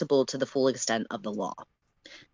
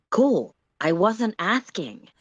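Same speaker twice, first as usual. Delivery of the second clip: dry insistence; 6 semitones higher, 3 dB louder, slower than usual. Acted. Sullix overruling firmly.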